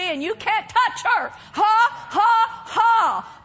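preacher acting out God's triumphant rebuke; defiant divine mockery. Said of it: "you know what he says?".

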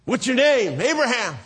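high, loud question and answer; insistent exchange. Retold or imitated. Stranger asks Abraham after twenty years, insistent exchange. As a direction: press it with mounting exasperation.

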